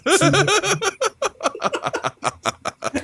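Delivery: long, high-pitched laughing fit; real extended laugh; high laughter. Real, not acted. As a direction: laugh hard at the end of your own story. Laughing at end of own story.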